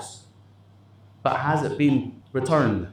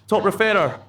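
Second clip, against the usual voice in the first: raised, high-pitched award call; high, projected call of the award title; award announcement; 7 semitones higher, 4 dB louder than usual. Real, not acted. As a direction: announce the category over a noisy room. Repeating award announcement to crowd.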